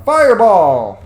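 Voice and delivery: loud, high spell shout; big playful battle cry; calling out spell. Pretend.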